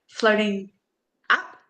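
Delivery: ordinary talk, nothing marked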